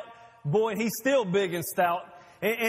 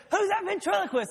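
high, amazed crowd voice; puzzled onlooker; 12 semitones higher than usual. Retold or imitated. Onlookers staring at Jesus and Zacchaeus, high and puzzled. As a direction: ask it high and baffled. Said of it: "They said".

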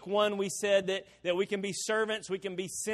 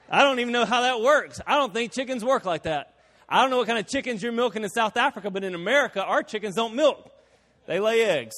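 loud comic disbelief; 2 semitones higher, 5 dB louder than usual. Real, not acted. Preacher joking about milking chickens, loud and incredulous. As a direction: protest it loudly for laughs.

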